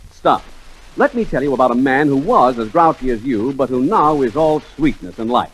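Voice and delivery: ordinary talk, nothing marked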